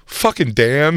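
hammed-up annoyed grumble; mock griping. Griping about Dan to his girlfriend, mock annoyed.

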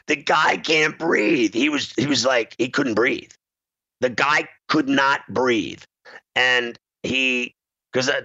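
ordinary talk, nothing marked

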